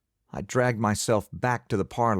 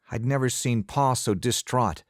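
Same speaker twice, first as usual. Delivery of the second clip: ordinary delivery, nothing marked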